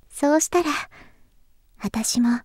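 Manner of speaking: soft, whispery tender promise; intimate confession. Acted. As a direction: confide your love, hushed and unhurried.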